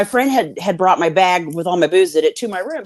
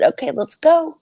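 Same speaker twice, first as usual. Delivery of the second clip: cheerful readiness; 9 semitones higher than usual. Retold or imitated. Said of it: "and I said".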